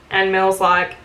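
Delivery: ordinary talk, nothing marked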